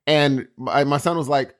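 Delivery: normal talking voice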